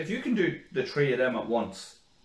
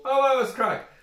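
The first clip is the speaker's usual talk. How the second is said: mock high exclamation; 11 semitones higher, 5 dB louder than usual. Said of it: "you go".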